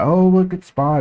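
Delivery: jumps high in pitch to mock; a quick jab of mimicry; mocking the other trader. Imitating the guy's chatter about spy, high and mocking. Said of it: "whatever he was talking about".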